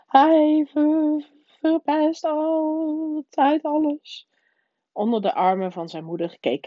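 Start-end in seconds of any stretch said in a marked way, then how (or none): 0.1-4.3 high, drawn-out whiny wail; fake sobbing. A narrator's reading. Spoilt son complains to mother through fake sobs, wailing. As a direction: stretch it into a bratty blubber. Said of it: "geveinsde snikken door".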